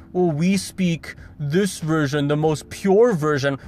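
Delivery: slow, lightly put-on haughty voice; lightly lampoon a smug purist; mock snobbish purist. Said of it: "It's not".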